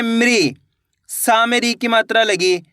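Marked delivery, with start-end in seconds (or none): 0.0-0.5 मृ said as one syllable, m with ri; teacher modelling मृ; pronunciation demo. Shown as a demonstration. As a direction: say मृ as m gliding into ri. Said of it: "माँ का उच्चारण कैसे करेंगे".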